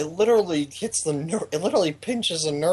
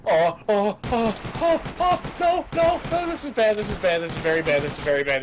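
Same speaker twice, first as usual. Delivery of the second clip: rising panicky oh no; panic, voice climbing high; panicked; 5 semitones higher than usual. Real, not acted. Panics as enemy attacks.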